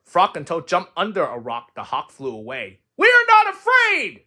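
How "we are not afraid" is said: very high, loud story scream. Acted. Frog and Toad insist together after the hawk, high scream. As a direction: scream it out in defiance. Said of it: "screamed Frog and Toad".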